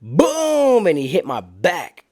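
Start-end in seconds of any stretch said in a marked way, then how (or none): 0.0-0.9 high, drawn-out vocal boom; imitated shot boom. Tower shot hits his back, imitated boom. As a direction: sound out a sudden impact. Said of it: "all I hear is".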